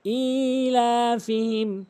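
ইলাফিহিম with a long held opening ee; slow chant, "eee-laa-fihim"; correct elongation. Shown as a demonstration. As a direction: stretch the first vowel of ইলাফিহিম. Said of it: "টেনে পড়তে হবে".

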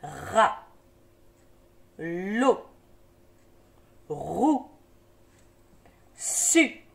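syllables from "Rat" to "Fu" one by one, first consonant drawn out; syllable drill. Shown as a demonstration. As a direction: hold each opening consonant, then release the vowel. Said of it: "j'accorde avec la consonne devant".

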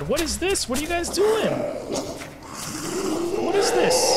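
high-pitched alarmed questioning. Real, not acted. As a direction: exclaim it high, in disbelief. Alarmed at creatures in game.

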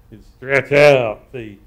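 "stretch out" drawn long and very loud; stretched-out vowel. Shown as a demonstration. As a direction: slowly stretch the word "out" at a big volume. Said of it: "by stretching the vowel sound out".

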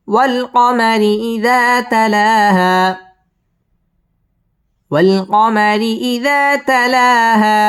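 slow, high with lengthened alif; slow model recitation. Shown as a demonstration. Teacher repeating the verse after tajweed rules, slow chant. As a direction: stretch the long vowels.

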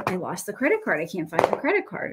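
ordinary talk

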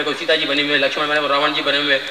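usual speaking voice

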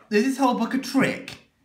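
a reader begging to stop; pleading. Acted. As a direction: beg to be let off.

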